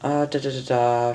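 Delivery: plain reading voice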